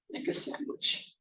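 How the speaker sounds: breathy whisper, playful; whispered repeat. Shown as a demonstration. Teacher whispering the phrase to the class. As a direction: whisper it to yourself with relish. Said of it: "to make a sandwich".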